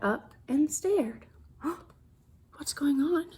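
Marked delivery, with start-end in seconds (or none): 1.6-3.3 sharp gasp, then a hushed whisper. Acted. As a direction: draw the kids in with a secret whisper. Reader reacts in suspense.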